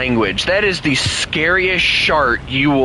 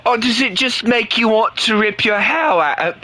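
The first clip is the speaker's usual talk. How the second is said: higher mock British accent; 8 semitones higher than usual. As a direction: tease Chris in a put-on British accent. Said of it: "speak the British language".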